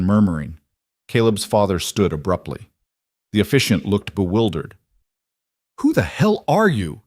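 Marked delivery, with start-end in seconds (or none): 5.8-7.0 sharp, higher demand; angry demand. A narrator's reading. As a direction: demand to know who he is. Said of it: "Caleb demanded".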